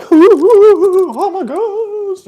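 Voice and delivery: high, wailing mock ghost; spooky ghost. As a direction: hoot and wail like a cartoon ghost. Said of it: "You know, like ghosts do".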